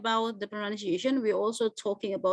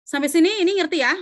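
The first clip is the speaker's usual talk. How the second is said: ordinary delivery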